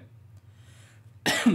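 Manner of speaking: harsh throat clear. Real, not acted. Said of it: "So excuse me for coughing".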